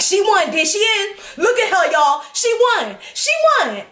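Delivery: high, hollering hype-man voice; excited shouted announcement. Retold or imitated. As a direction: imitate a boy cheering her win. Boy's excited announcement retold.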